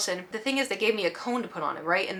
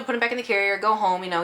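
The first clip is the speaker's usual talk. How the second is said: normal talking voice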